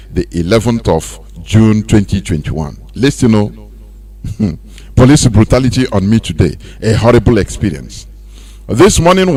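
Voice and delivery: low, steady read-out of a written testimony; reading a post aloud. Acted. Pastor reads a victim's post to listeners, steady read-out. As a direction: read it out like a quoted letter.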